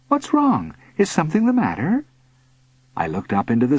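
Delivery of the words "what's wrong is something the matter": high-pitched, anxious questions; worried mother. A narrator's reading. Mother noticing son's upset, worried. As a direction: ask with rising concern, quickening.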